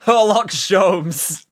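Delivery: drawn-out, teasing repeat of "Herlock Sholmes"; mocking repeat. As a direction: stretch "Herlock Sholmes" very slowly to mock the name. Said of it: "Look at this himbo".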